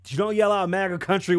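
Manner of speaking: "maga country" yelled out loud and high; stress on maga country. Shown as a demonstration. Showing viewers how a yelled slur sounds, "maga country" shouted. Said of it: "when you're yelling out maga country".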